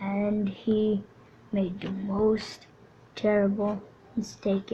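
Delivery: soft, mournful storyteller near a whisper; hushed tragic narration. A narrator's reading. Narrator telling the hero's death, hushed and mournful. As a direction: mourn the hero as you tell it.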